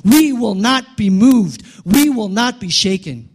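high, emphatic preaching crescendo; preaching at full force. Real, not acted. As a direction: drive each phrase home to the congregation.

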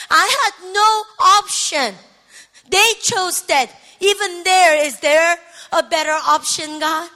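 pleading that builds to a loud cry; pouring out heart. Retold or imitated. Daughter asking God about those who left, building to anguished shouting. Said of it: "pour out my heart".

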